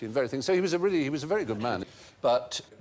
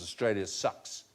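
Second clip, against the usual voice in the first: put-on New Zealand accent; 3 semitones lower, 6 dB quieter than usual. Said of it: "they would pronounce as".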